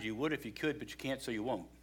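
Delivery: softly, like a familiar maxim; quiet saying. Shown as a demonstration. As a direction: say it low, almost to yourself. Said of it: "Statement I say to people sometimes".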